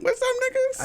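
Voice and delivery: squeaky falsetto greeting; high-pitched voice. Pretend. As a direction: pipe up in a mock high voice. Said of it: "you gotta go more high pitched".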